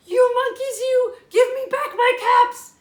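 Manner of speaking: angry peddler demo. Acted. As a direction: shout it with both fists. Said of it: "I'm gonna take both my hands".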